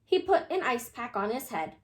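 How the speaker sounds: ordinary delivery, nothing marked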